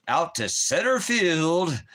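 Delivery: high, loud, slow stadium announcer call; mock play-by-play call. Sportscaster calling a pop fly, drawn out. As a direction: belt it out like a ballpark announcer.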